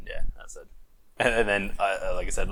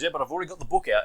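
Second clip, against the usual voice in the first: rapid excuse; 7 semitones higher, much faster than usual. Retold or imitated. Cop gives absurd excuse fast.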